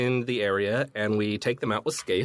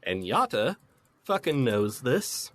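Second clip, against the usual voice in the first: ordinary talk, nothing marked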